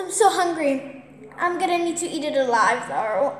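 ordinary talk, nothing marked

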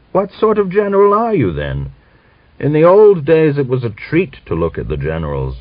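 old man mocking Grigor's muddy coat; scornful. A narrator's reading. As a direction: jeer at the shabby general.

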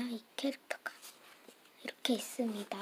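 whisper. Real, not acted. Showing stickers, suddenly hushed.

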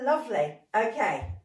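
ordinary delivery

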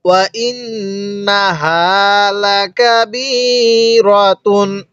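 ওয়া ইন্নাহা slowly, the doubled ন hummed through the nose; with ghunnah. Shown as a demonstration. Teacher demonstrating ghunnah to students, slow nasal recitation. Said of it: "কিভাবে পড়ব?".

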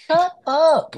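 dismissive mock retort. Mocking reply to online hater.

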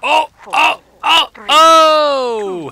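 high, loud repeated cries; alarmed shouting. Real, not acted. Player reacting to the game, high startled shouts. Sudden outburst during game play.

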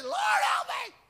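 high, loud shout for help; desperate cry. Acted. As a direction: cry out like a drowning man. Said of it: "Because I know he said".

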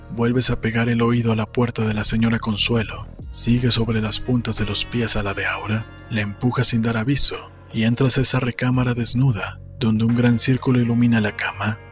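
ordinary delivery, nothing marked

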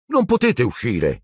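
high insistent protest; insistent boy. A narrator's reading. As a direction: pitch it up and insist. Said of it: "insisté Neville". (insistente, voce acuta da ragazzino)

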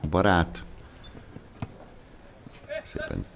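ordinary delivery

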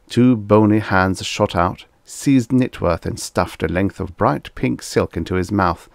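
plain reading voice

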